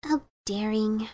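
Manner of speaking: soft, slow, pitying sigh. Acted. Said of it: "She sighed".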